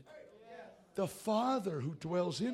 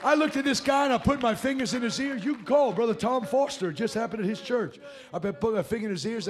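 louder, higher, shouted sermon; preaching at full force; 7 semitones higher, 10 dB louder than usual. Acted. Preacher shouting at parents in the congregation, fiery and loud. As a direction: rail against the lie with full fire.

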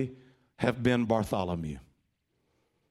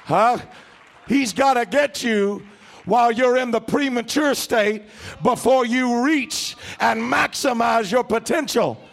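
building to a shout; 9 semitones higher, 6 dB louder than usual. Real, not acted. Sermon climax, building shouting.

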